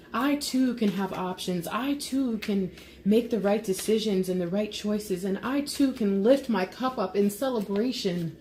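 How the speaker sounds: fervent, building praise; passionate channeled message. Acted. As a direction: pour it out with rising fervour.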